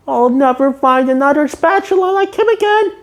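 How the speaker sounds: a touch of sad cartoon voice; lightly voiced SpongeBob. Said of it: "SpongeBob concludes".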